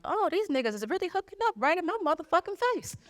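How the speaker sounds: high disbelieving squeal; shocked they're flirting right in front of her; outraged disbelief; incredulous. Retold or imitated. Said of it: "I'm like".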